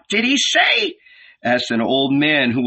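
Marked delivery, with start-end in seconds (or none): 0.1-1.0 high voice, much higher; old man voice. A narrator's reading. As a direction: ask as a confused old man. Old deaf man asks.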